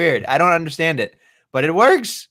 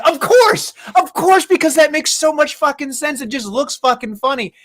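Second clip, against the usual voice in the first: much higher gleeful delight; 10 semitones higher than usual. Retold or imitated. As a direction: squeal it with glee. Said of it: "And I was like".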